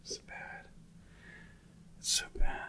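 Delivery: hushed, breathy whisper. Real, not acted. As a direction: whisper it under your breath. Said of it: "why are you whispering?".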